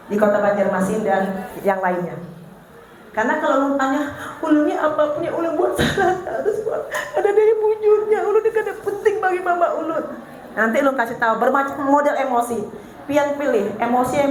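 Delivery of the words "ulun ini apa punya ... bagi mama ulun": put-on hurt child. Child saying feeling unimportant.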